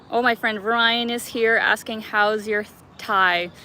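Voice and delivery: ordinary talk, nothing marked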